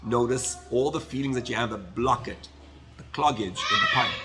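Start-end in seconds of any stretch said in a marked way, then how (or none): 3.0-4.2 shout. Real, not acted. Preaching about blocked feelings.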